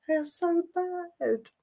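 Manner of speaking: high, slow, soft lament; softly wailed complaint, slow and high; drawn-out dismay. Real, not acted. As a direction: stretch it out high and quiet in dismay. Repeats complaint about bad accents.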